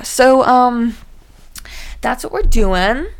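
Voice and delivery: ordinary delivery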